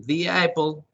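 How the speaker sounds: "the" pronounced "di" before "apple". Shown as a demonstration. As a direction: glide "the" into "apple" as "di apol". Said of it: "se pronuncian the".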